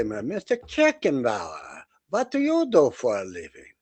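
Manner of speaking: Mister Kirchenbauer said slowly with German sounds; German pronunciation of Kirchenbauer. Retold or imitated. German captain addressing the comedian, slow German accent. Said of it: "So he knew how to say".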